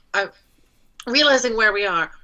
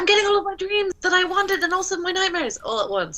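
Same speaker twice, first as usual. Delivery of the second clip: overwhelmed character voice; 11 semitones higher than usual. Acted.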